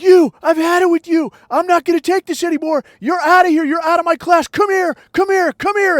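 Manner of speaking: high, loud angry teacher rant; imitating a teacher losing it at a kid; retold yelling. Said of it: "starts yelling at me".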